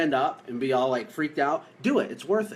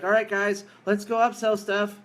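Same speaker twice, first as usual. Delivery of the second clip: mock pep talk; 6 semitones higher than usual. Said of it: "you tell your team".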